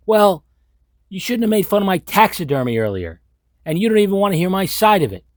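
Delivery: high, loud, whiny self-justifying retort; petulant indignant Norman. Retold or imitated. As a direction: play the wronged creep defending himself. Norman Bates retort in mock scene.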